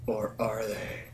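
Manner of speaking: hushed, creepy hint someone's there; spooky whisper. Pretend. Teasing viewers that someone hides in the room, whispered. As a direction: whisper it like a ghost story tease.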